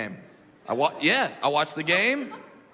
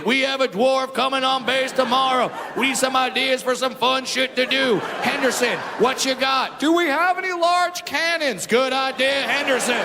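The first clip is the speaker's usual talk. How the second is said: commanding officer briefing; 6 semitones higher than usual. Retold or imitated. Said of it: "some commanding officer is on stage".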